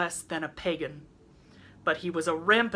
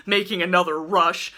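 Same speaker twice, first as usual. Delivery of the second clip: plain reading voice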